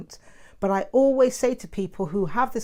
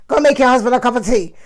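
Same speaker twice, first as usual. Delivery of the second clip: loud, fast mock bossy mum; hammed-up shouting mum; imitating mum's order; 5 semitones higher, 13 dB louder, faster than usual. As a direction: bark the order like an insistent mum. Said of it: "like my mum".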